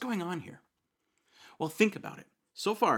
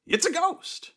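much higher, louder frightened shriek; mock terror; 8 dB louder, slower than usual. Disciples spotting a figure on the lake, mock terrified. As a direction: squeal it in fright.

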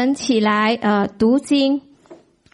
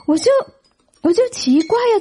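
ordinary delivery

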